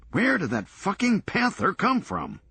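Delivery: high, shocked yelp at the panther; startled outburst. Acted. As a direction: blurt it out in sudden alarm. Panther suddenly leaps out.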